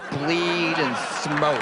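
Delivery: very slow, stretched BLEED and SMOKE; drawn-out repeat. Real, not acted. Comedian relishing the bar girls' routine, slow drawn-out repeat. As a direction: linger on "bleed" and "smoke", drawing each out. Repeats the phrase for comic effect.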